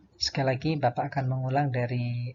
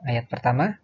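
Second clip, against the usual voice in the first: ordinary talk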